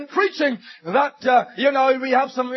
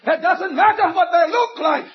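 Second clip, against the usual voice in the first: high, ringing pulpit fervour; impassioned preaching; 9 semitones higher than usual. Real, not acted. Preacher telling congregation the person makes the difference, impassioned preaching. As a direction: proclaim it to the congregation, pitched up.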